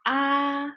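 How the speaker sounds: "ah" held high and level; first tone. Shown as a demonstration. Teacher gives an example, "ah" in high first tone. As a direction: sustain a high, flat "ah". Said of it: "first one".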